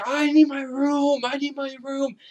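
high-pitched mock outrage; angry police guy. Angry cop demanding his room at reception, mock-angry. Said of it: "there's this really angry police guy".

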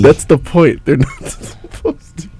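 laughing at own pun; chuckling, pleased with herself; amused. Real, not acted. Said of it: "You sound pretty amused".

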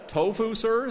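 high, loud questioning echo; baffled hotel manager's question; incredulous query. A narrator's reading. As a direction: repeat it back in polite disbelief. Manager echoes the answer in disbelief.

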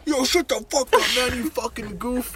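imitating a yell. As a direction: bark it like a street brawler. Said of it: "He starts yelling".

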